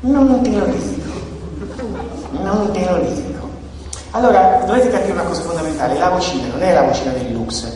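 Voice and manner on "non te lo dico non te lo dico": a hint of a sly little creature refusing. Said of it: "E poi questa vocina". (vocina leggermente imitata, un po' più acuta)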